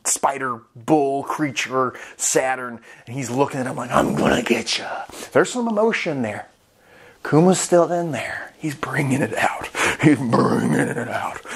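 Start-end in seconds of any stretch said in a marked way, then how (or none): 3.9-5.0 low menacing threat. Retold or imitated. Kuma threatening Saturn, low and menacing. 7.2-11.4 hushed. Real, not acted. Hushed dramatic commentary.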